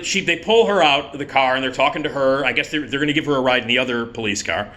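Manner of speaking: usual speaking voice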